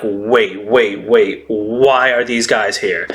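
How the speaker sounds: low-pitched, mild mock bewilderment; freaked out. All-Stars seeing the Scabbards, mildly freaked out. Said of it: "they're just so freaked out".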